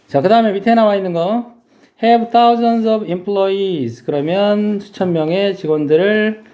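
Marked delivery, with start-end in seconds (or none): 2.0-4.0 very slow English reading. Shown as a demonstration. Teacher reads the example phrase to students, very slowly. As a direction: Have thousands of employees read very slowly, word by word. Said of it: "employees를 쓴 거죠".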